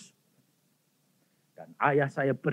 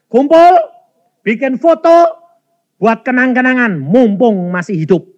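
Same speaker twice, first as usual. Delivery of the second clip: loud, high calling out; rally the family at full voice; retold bossy call to siblings; urgent summons; 8 semitones higher, 11 dB louder than usual.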